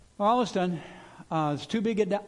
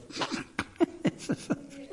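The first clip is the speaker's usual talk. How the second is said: breathy unvoiced laugh; 11 semitones higher, 4 dB quieter than usual. God laughing at plans.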